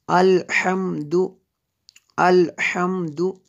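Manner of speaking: अल्हम्दु chanted syllable by syllable with a pharyngeal H; Tajweed अल्हम्दु with a strong throat H. Shown as a demonstration. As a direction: recite अल्हम्दु measuredly, H from the throat. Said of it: "बल्कि ये हा जो है".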